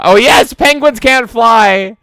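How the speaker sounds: high, loud, slow mock critic; hammed-up critic imitation. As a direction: savor each word.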